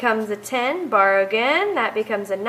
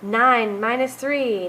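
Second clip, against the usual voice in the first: normal talking voice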